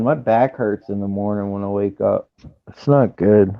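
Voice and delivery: ordinary talk, nothing marked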